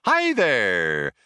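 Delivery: loud, over-friendly computer greeting; brash, eager machine voice; chirpy computer. A narrator's reading. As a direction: sing out a big eager hello.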